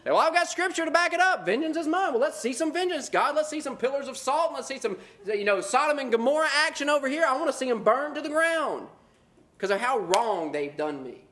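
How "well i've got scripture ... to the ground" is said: high, gloating mock cry for fire; mock gleeful Jonah. Preacher plays Jonah gloating on the hill, high mock glee. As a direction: cheer for destruction like Jonah on the hill. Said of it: "you sit back like Jonah".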